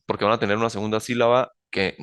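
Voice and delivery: ordinary delivery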